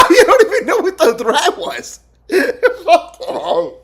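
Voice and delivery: high, laughing echo; laughing repeat. Real, not acted. Streamer echoes the president, laughing hard.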